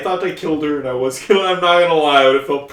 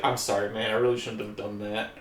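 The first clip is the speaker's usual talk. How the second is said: lower, softer mock apology; imitated apology; 7 semitones lower, 8 dB quieter than usual. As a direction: drop down quietly to imitate his apology. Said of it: "and then he's like".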